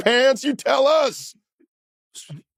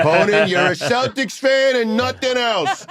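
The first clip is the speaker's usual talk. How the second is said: put-on Boston accent; 8 semitones higher than usual. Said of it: "a guy, an alewife, right now pulling his hair out like".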